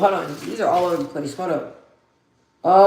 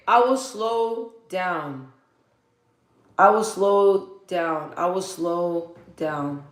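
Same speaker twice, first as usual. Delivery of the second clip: very slow, spaced-out words; 2 semitones lower, 3 dB quieter, much slower than usual. Real, not acted. Streamer promising a subscriber to slow down, very slowly. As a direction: draw each word out deliberately. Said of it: "I'm slowing down".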